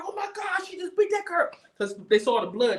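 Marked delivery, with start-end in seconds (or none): 0.0-1.5 shocked onlookers. Retold or imitated.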